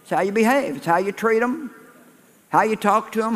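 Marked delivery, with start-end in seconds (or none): none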